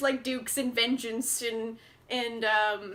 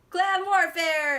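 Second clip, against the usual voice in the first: slow, loud, higher "Clan warfare!"; mock battle cry; 5 semitones higher, 6 dB louder, slower than usual. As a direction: bellow it like a hammed-up Highlander. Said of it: "he's like".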